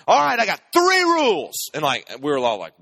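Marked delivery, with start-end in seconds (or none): 0.1-1.7 loud, slow and much higher, laying down the law; hammed-up wife barking her rules; mock angry wife. Wife storming in to announce dinner rules, loud and slow. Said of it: "my wife loses it".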